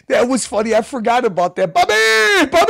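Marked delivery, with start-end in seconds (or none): 1.7-2.7 high mock holler; imitated calling out. Host imitating a man calling Bobby, hollering. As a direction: yelp the name in a high imitation. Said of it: "Oh, he's like".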